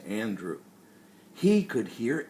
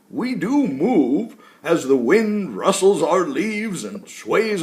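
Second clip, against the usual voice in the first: put-on loud, jolly old tree voice; woody tree voice; 2 semitones higher, 6 dB louder than usual. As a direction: speak like a giant, cheerful old tree. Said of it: "deep, woody-sounding words came out".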